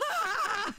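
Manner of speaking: excited yelp. Retold or imitated. Dog reacting, retold.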